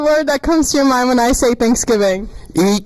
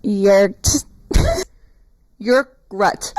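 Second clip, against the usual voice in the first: backwards speech; much slower than usual. Shown as a demonstration. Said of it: "Say it backwards".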